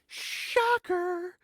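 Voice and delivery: high, sarcastic exclamation; mock surprise. Reacting to British actors having British accents, mock astonishment.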